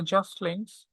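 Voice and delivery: ordinary delivery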